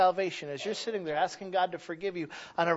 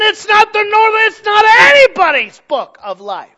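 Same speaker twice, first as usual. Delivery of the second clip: full-force preaching shout; 13 semitones higher, 17 dB louder than usual. Real, not acted. Preacher denouncing man-made books of life, shouting. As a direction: shout each denial, building by repetition.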